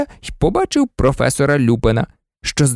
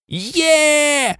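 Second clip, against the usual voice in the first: high, drawn-out yell of triumph; shrieked cheer; 11 semitones higher than usual. A narrator's reading. Ron celebrating the win with Harry, shrieking. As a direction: squeal it out high and long. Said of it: "верещав Рон".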